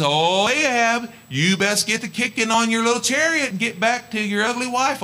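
high, teasing command to Ahab; mocking. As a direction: shoo him home with a jab. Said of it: "he didn't say ugly wife".

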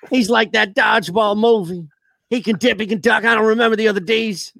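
high twangy coach impression; Gruden twang. Coach comparing Jackson to Dodgeball, full twang. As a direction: go full twang, pitched well up. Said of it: "I want the full twang".

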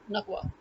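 "Nagwa" as nug-WAH, hard g; hard g in the middle of "Nagwa"; Egyptian version. Shown as a demonstration. Explaining the Egyptian form of her name, hard g. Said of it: "their J becomes G".

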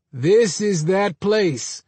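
very slow, high, drawn-out words; eerie pronouncement. A narrator's reading. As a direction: stretch out every word, pitched high. Eerie patient's pronouncement in story.

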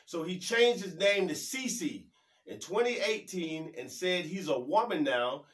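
ordinary delivery

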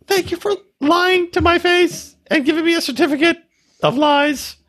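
very high, squeaky imitation; mock her in a high, wailing falsetto; mock upset woman. Mocking an emotional woman's complaint.

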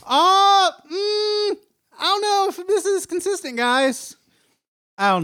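high, loud whiny complaint; whiny outraged fan. Retold or imitated. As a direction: shriek it like an offended fan.

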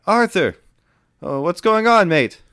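high, loud friendly hail; calling down. Acted. Boy up the mast greeting Arthur below, calling out. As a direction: call out from up in the rigging.

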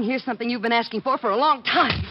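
defiant anger. Acted. She slaps and quits the job.

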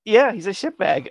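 ordinary talk, nothing marked

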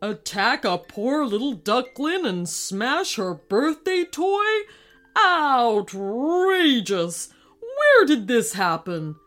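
loud, slow outraged royal voice; outraged king. Acted. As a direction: bellow like an offended monarch. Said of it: "King Mallard".

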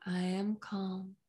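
soft, slow hypnotic induction; slow hypnotist voice. Acted. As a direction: lull them down gently and slowly. Hypnotic affirmation.

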